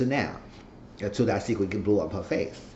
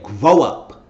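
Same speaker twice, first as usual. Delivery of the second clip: mock stern yell; 3 semitones higher, 12 dB louder than usual. He snaps at the snitch.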